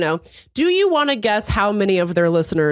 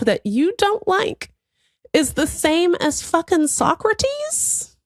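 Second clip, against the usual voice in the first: mocking disbelief; 9 semitones higher, slower than usual. She mocks the caller as Homer.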